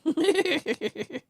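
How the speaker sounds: loud high laugh; high bright laughter. Real, not acted. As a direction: burst of laughter.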